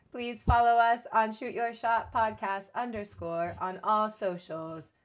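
slow, mechanical outro read; stiff, measured end-of-episode announcement; robotic sign-off. Acted. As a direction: recite it slowly like a robot. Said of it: "my robotic end of the episode voice".

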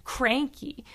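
usual speaking voice

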